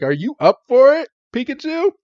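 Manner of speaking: high, rising rallying question; eager rallying. Retold or imitated. Ash asking Pikachu to fight, eager rallying. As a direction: imitate a keen trainer pumping up a partner.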